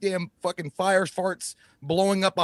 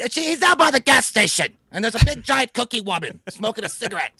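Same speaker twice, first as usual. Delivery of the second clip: hammed-up young girl; Jamie's line; 8 semitones higher than usual. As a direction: blurt it out like a frightened kid. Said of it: "that stupid shit Jamie had just said".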